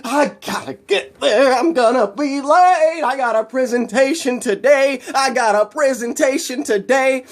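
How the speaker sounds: high, stretched-out hurried wail; frantic. Acted. Man running late for his presentation, frantic.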